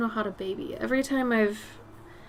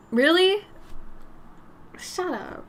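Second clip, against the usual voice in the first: surprised; 8 semitones higher, 4 dB louder, slower than usual. Real, not acted. Delighted reaction to viewer compliment.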